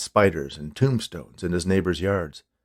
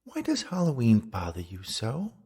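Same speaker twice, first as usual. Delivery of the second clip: softer, lilting ghost woman; ghostly female voice; 3 semitones higher, 5 dB quieter than usual. A narrator's reading. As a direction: swoop down and up into a coaxing question. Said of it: "a female voice whispered in the dark".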